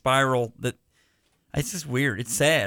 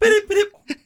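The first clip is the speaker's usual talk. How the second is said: high, louder beeping broken by laughter; giggly telegraph chirps, much higher; mock telegraph beeps; 6 dB louder than usual. Joking about telegrams on a podcast, laughing high mock beeps. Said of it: "Was that two long beeps or one short beep?".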